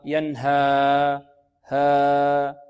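wrong ينهى, final alif dragged too long; مد زائد خاطئ. Shown as a demonstration. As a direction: overstretch the end of ينهى past two beats. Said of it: "هذا خطأ". (ينهى بمد يزيد على حركتين)

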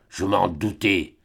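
low, slow muttered suspicion; muttered through teeth. A narrator's reading. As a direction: chew the words with contempt. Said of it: "mâcha-t-il entre ses dents".

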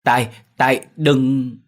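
high, loud frightened cry to her son; panicked cry. A narrator's reading. Mother cries out in panic to warn her son Tài. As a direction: cry out a desperate warning. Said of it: "Bà Vân hốt hoảng kêu".

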